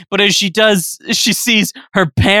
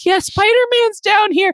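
put-on very high child voice; little brother insisting from the sewer; squeaky kid.

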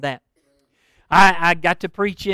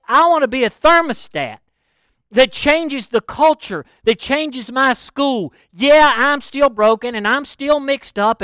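high, loud, fervent preacher's cry; impassioned preaching declaration; 7 semitones higher, 6 dB louder than usual. Acted. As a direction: declare it to the Lord with rising force. Voices believers' declaration to God.

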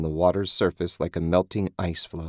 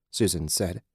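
plain reading voice

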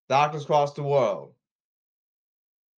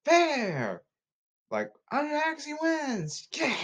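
high, drawn-out tennis call; high-pitched mock cheer for a winner; tennis match call; 14 semitones higher, slower than usual. Acting out tennis calls and cheering.

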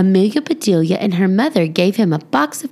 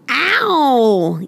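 a sharp, higher ow of pain; mild yelled ow; 5 semitones higher than usual. A narrator's reading. Clay yelps after a punch on the arm. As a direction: cry out as if punched. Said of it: "yelled Clay".

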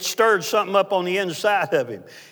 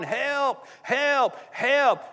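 mock hollering; 9 semitones higher, much slower than usual. Preacher acts out man trapped in a grave, mock hollering. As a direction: holler for help in a retold panic. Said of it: "he was hollering".

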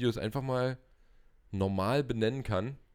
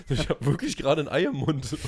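laughing; 6 semitones higher, 4 dB louder than usual. Retold or imitated. Retold reply, laughing through it.

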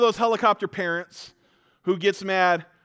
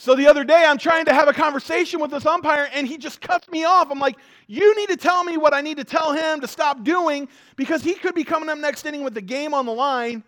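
worked-up retelling; 10 semitones higher, 6 dB louder than usual. Retold or imitated. Retelling heated argument with umpire.

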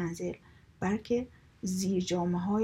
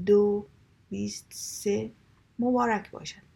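auctioneer call. Acted. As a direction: call out the bids to the whole room. Auctioneer calling the bids.

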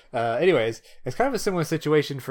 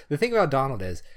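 ordinary delivery

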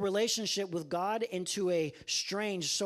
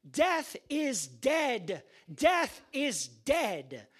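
very slow, louder proclamation; triumphant Lazarus; 8 semitones higher, 5 dB louder, much slower than usual. Acted. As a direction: savour every word. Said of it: "And Lazarus saying".

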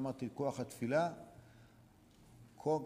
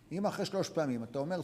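ordinary talk, nothing marked

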